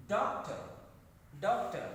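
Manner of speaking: DOCTOR punched on DOC; first-syllable stress. Shown as a demonstration. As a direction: hit DOC hard, let the ending fall. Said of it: "likewise here".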